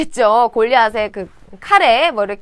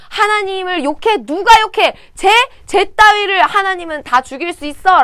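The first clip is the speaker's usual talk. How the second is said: higher, louder, outraged; heated anger; 6 semitones higher, 4 dB louder than usual. Acted. David ignoring his brothers, furious over the insult to God. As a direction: snap back, burning with indignation. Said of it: "열이 받은 거예요".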